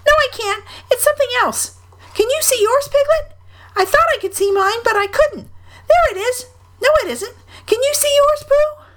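very high, squeaky little voice; excited Roo. A narrator's reading. Roo asks friends about the sticks, excited squeak. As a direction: pipe up eagerly, high as a small child. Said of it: "cried Roo".

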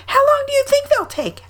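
put-on very high squeaky child question; Roo. A narrator's reading. Roo asks Rabbit how long, high squeak. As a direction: ask it in a tiny high voice. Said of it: "asked Roo".

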